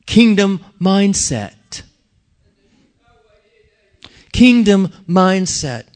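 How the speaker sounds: KINGDOM drawn out slowly; stress on kingdom. Acted. Preacher to congregation, slow emphatic KINGDOM. Preacher repeating key phrase.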